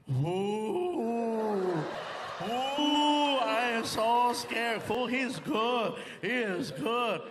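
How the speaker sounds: very slow, drawn-out mock fright; stretched, lazy, unbothered drawl; Felipe. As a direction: imitate a spaced-out friend feigning fear. Friend Felipe mocks the cop.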